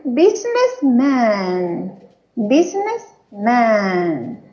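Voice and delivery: "businessman" drawn out slowly, syllable by syllable; slow teaching pronunciation. Shown as a demonstration. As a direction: spell out "businessman" slowly for the class. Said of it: "¿Qué es eso?".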